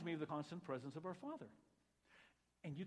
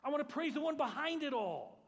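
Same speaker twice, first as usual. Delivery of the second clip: loud praise; 10 semitones higher, 9 dB louder than usual. Acted. Quoted prayer of praise.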